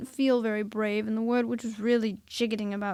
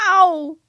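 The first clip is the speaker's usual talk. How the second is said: sharp loud yelp of pain; 7 semitones higher, 16 dB louder than usual. A narrator's reading. Pooh bumping jar on things.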